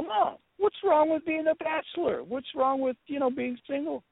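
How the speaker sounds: high-pitched, pleading objection; imitated protesting son. Bachelor son defends being single to Mom, imitated protest. Retold protest to his mother.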